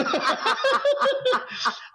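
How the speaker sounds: long high-pitched burst of laughter; big high giggling fit; hearty laugh. Real, not acted. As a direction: laugh hard at your own joke. Laughing at own joke.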